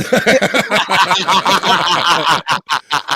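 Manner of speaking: loud, high burst of laughter; real, sustained belly laugh; hearty laughter. Real, not acted. Hearty laugh at guest's joke.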